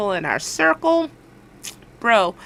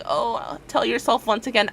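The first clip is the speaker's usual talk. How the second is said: ordinary talk, nothing marked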